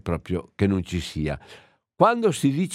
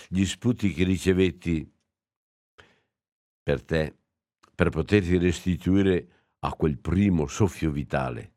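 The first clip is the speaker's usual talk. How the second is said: solemn homily reading; 4 semitones lower than usual. Acted. Priest reading the Holy Saturday homily to his listeners, solemn and measured. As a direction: read it like a sacred text from the pulpit. Resumes homily after page turn.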